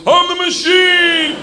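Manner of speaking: high, loud, drawn-out yell; shouted catchphrase. Acted. Student using his only Russian on gangsters, high long shout. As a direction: shout it high like a war cry.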